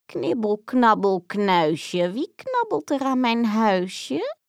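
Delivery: slow, creaky witch chant from inside; a lurking old witch sing-songs her rhyme; strange witch voice. Acted. Witch calls out to the nibbling children in a strange voice. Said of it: "klonk er een vreemde stem".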